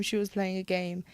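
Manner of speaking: ordinary delivery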